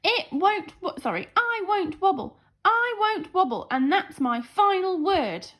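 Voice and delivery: a stubborn jelly refusing to budge; jelly screaming. A narrator's reading. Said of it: "screamed the jelly".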